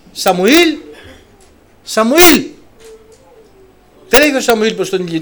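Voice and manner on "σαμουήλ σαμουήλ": high, loud calling of the name. Pretend. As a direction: call him from afar, raised and ringing. God calling the boy Samuel.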